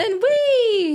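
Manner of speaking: high delighted whee; giddy high-pitched cheer; excited squeal. Real, not acted. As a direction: squeal with glee. Playful squeal at compliment.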